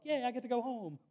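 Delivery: high, bright mock celebration; mock cheer. Pastor freed early from church, mock glee. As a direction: cheer like a kid let out early. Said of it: "And I'm like".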